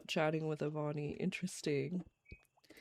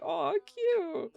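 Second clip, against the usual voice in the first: squealing aw. Retold or imitated. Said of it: "I was like".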